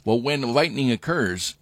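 ordinary talk

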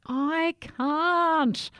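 high, loud desperate cry. Acted. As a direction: cry out in despair. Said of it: "cried Jack".

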